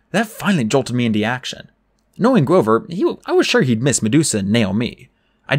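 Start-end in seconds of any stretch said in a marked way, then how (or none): none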